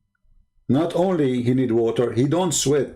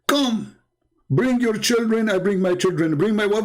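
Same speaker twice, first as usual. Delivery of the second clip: higher-pitched mocking invitation; mock divine summons; 7 semitones higher than usual. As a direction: call them over in a raised, mocking voice. Mocking a god's summons in acted quote.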